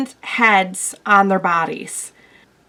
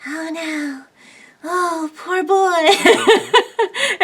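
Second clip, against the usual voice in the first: shy awkward self; 9 semitones higher, much slower than usual. Retold or imitated. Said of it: "really shy in front of the camera and awkward".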